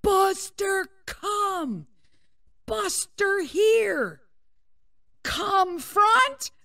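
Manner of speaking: high, drawn-out calling; re-enacted yelling for the dog; yelled recall commands. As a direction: call a runaway dog back across a field. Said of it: "and I'm just yelling".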